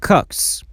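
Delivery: "cooks" ending in a hissed s after k; voiceless s ending. Shown as a demonstration. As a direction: finish "cooks" on pure s, no buzz. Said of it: "S or E S is pronounced as s". («cooks» با س بدون لرزه)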